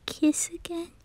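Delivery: shy whispered question. Acted. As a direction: barely voice it, almost afraid to ask. Shy request for a kiss.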